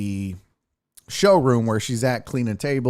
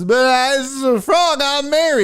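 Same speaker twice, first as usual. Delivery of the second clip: heckler telling his joke; 5 dB louder than usual. Retold or imitated. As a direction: ham up a lame frog joke. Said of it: "And then he goes".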